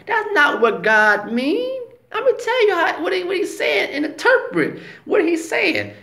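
high, slick, coaxing serpent voice. Retold or imitated. Serpent tempting Eve in the garden, high and slick. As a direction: pitch it way up and sweet-talk her. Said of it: "in a slick play away".

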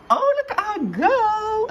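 very high, slow coo. Real, not acted. Cooing as the fish swims about.

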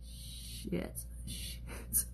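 whispered shit under the breath; whispered curse. Real, not acted. Botching the nose contour, whispered shit. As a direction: hiss the shit to yourself. Makeup mistake.

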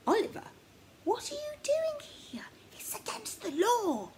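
put-on a scared kid caught by surprise, half whispering; high frightened boy's whisper; startled boy. A narrator's reading. Startled boy voiced in story.

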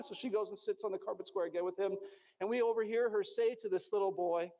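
ordinary talk, nothing marked

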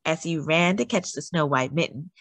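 plain reading voice

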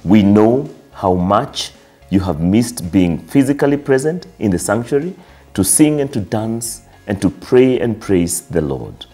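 ordinary talk

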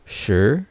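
"shi" starting low and rising; second tone. Shown as a demonstration. Teacher drilling learners in second tone, "shi" rising. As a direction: glide "shi" upward from low. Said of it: "One more time".

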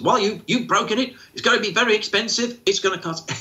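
a carer warning an agitated person of the cost, scolding; mock scolding about consequences. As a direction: lecture them on the cost. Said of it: "don't mention consequences".

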